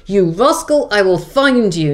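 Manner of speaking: put-on George the Second scolding in a light German accent; a hint of an angry German prince. Prince scolding the Duke, mock German accent. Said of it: "George the Second says to the Duke of Newcastle".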